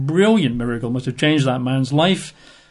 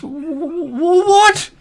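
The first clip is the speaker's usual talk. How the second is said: stammering, very high outrage; indignant surprise; slower than usual. Acted. Naaman finding only a messenger, stammering in high indignation. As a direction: sputter it out, much higher, affronted. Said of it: "Naaman's not gonna be impressed".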